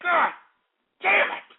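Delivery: high cursing burst; angry outburst. Real, not acted. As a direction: spit out the curse in outrage. Outraged rant.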